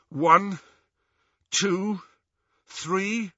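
high, suspended count with pauses; tense countdown. A narrator's reading. Harry counting down to open the locket, tense. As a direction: count up toward the moment, pitch rising.

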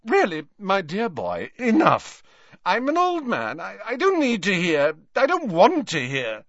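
put-on high, loud, agitated old man; distressed protest. A narrator's reading. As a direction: plead loudly for it to stop. Slughorn begs Harry to stop.

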